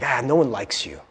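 low, soft, dismissive put-down; lightly voiced discouraging thought; quietly undermine them, a touch scornful; little inner voice. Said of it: "this little voice that's like".

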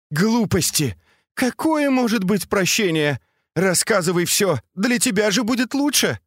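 high-pitched, insistent command; impatient scolding. A narrator's reading. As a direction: brush off excuses and demand everything. Mistress impatiently orders confession.

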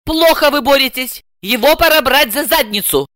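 high-pitched, forceful rebuke; scolding. A narrator's reading. Client scolding translator for weak fighting, indignant. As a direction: push the translator into action.

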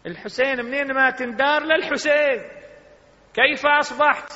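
high, loud, building to the question; rising sermon fervor. Acted. Preacher building up to Imam Husayn's answer, fervent. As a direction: lift the crowd toward the question.

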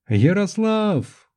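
high, drawn-out cry of a name; крик Анжелики. A narrator's reading. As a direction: call out desperately across a distance. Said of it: "кричала Анжелика". (протяжный высокий крик)

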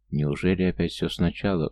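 usual speaking voice

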